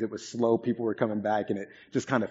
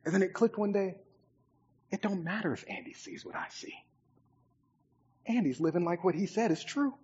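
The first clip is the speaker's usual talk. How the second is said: softer, earnest; quiet reflection; 9 semitones higher, 6 dB quieter than usual. Real, not acted. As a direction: bring it down to a sincere hush. Reflective soft conclusion.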